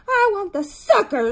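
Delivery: high, loud tantrum yell; shrill-child demand at high pitch; screamed demand. A narrator's reading. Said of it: "screamed Ramona".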